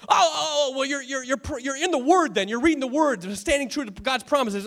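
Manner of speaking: high, stumbling realization; mock hopeful guessing. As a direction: imitate someone grasping for another explanation. Acted questioner grasping for an explanation.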